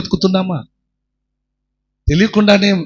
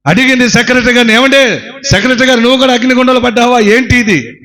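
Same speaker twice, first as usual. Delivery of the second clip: higher, fast and startled; shocked question; 7 semitones higher than usual. Acted. Mother finds the church secretary burning, shocked and quick. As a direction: gasp out the question in disbelief.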